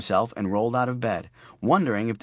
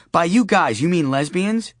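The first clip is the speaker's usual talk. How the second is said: cross-examining like a prosecutor; prosecuting attorney; 8 semitones higher, 5 dB louder than usual. Acted. Jane grilling Charlie on the phone, prosecutor tone. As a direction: pin him down like a prosecutor. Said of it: "sounding very much like a prosecuting attorney".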